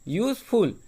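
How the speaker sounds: Useful split, a short stop before फुल; useful in two detached pieces, यूज and फुल; pause between syllables. Shown as a demonstration. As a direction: halt in the middle of useful, after यूज. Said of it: "हम रुक रहे हैं".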